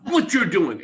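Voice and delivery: mock-exasperated plea building to a near shout; frustrated demand. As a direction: insist she finally explain herself. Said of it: "And I'm going".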